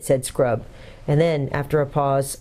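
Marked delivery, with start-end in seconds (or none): none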